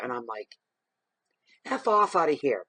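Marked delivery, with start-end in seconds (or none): 1.6-2.6 loud, high-pitched mock outburst; retold dismissal. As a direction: dismiss them loudly in mock outrage.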